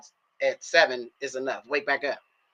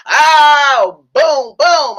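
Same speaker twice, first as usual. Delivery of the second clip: big laughing whoop, high and loud; loud, high laughing cry; laughing shout; 10 semitones higher, 13 dB louder, much slower than usual. Real, not acted. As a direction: burst out laughing at herself. Laughing shout at weed line.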